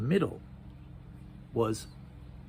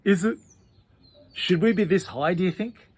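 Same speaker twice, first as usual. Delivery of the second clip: nervous Pete; 8 semitones higher, 10 dB louder than usual. Acted. Pete nervously asks Bean.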